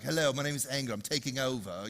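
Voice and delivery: Anger lightly voiced in a borrowed accent; show host's accent. Anger introduces itself like the TV host, lightly put-on accent. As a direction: play Anger with the TV host's accent, "over" as "ova". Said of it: "She has this accent".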